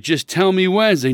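retold exasperated pleading for an answer. Retelling his exasperated phone argument.

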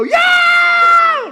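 long high-pitched scream; mock terrified scream. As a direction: scream "yeah" at full volume, drawn out. Demons greet a new follower.

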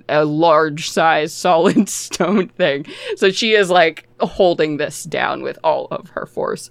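normal talking voice

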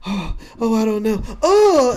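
startled intern spilling a drink; nervous intern startles and yelps; flustered intern. Acted. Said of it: "some intern's like".

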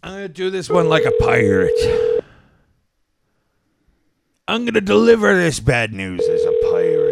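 playful mock pirate delivery; lightly voiced pirate announcing bad news. Host warming up to deliver bad news, as a mock pirate. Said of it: "This is my pirate voice".

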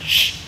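"sh" as a long unvoiced hiss; hush-hiss "sh" with no voice; sh sound. Shown as a demonstration. Teacher sounding "sh" for the class. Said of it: "makes a S H sound".

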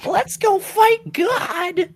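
very high, slow squeaky voice; drawn-out squeaky cartoon line; cute anime voice. Joins the cutesy anime voice.

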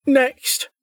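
high, bored official call; bored woman. A narrator's reading. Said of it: "called a bored woman's voice".

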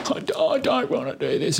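put-on high, whiny voice of a crying boy; whining kid. Dad mimics his crying son refusing to keep running. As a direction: mimic the kid who wants to quit. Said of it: "and he's".

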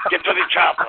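Austrian action-hero catchphrase; Arnold Schwarzenegger. Shown as a demonstration. Caller obliges a begging listener, Austrian action-star impression. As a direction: bark it in a thick fake Austrian accent. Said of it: "Can you please say".

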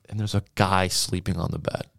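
ordinary talk

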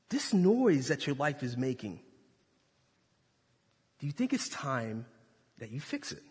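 soft, low, gentle question; quiet God voice. Pretend. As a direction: speak softly, almost fading.

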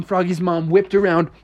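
plain reading voice

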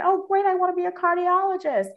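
hammed-up sudden enthusiasm; mock excitement. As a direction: play up your younger self's eager thrill. Retold eager new ambition.

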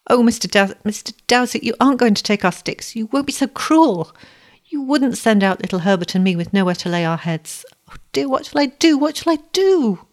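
high, distressed begging; frantic acted plea, pitched well up; desperate pleading. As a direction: plead with him, building to a high wail. Acted plea to the broker's man.

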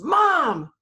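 put-on a toddler's sudden high yell for mom; retold shout. Toddler shouting for his mother near the hot grill, loud high yell. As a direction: call out loud and high, like a small child.

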